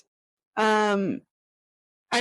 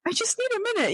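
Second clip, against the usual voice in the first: high, strained plea for a minute; lightly acted frustration, pitched high; high-voiced I just need a minute; frustrated plea; 12 semitones higher than usual. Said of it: "I'm just like".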